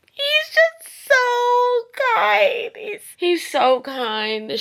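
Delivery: very high, slow squeal melting downward; swooning. Real, not acted. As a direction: squeal it drawn out, overcome by his kindness. Swooning over the book's hero.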